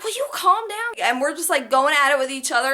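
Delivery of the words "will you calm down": put-on boyfriend, much higher. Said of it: "And he's like".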